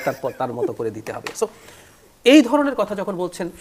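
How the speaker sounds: ordinary talk, nothing marked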